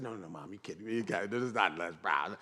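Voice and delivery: low, muttered disbelief; mock disbelief. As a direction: brush mom off in a low, rushed voice. Young self disbelieves his mother.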